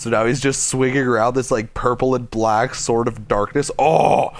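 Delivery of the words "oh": delighted high loud oh; excited. Real, not acted. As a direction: burst out in delight. Thrilled by the purple sword.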